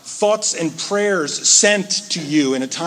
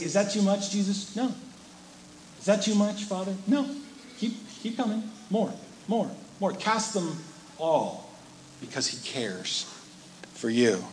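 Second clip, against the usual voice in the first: much softer, gently coaxing; tender hushed dialogue; 3 semitones higher, 9 dB quieter than usual. Pretend. Preacher voicing God welcoming every worry, hushed and tender. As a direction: keep them coming, softly.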